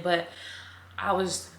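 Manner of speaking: normal talking voice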